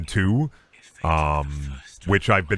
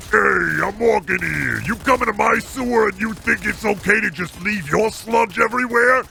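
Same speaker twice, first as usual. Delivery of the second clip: put-on New York monster accent; 11 semitones higher, slower than usual. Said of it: "New York monster accent".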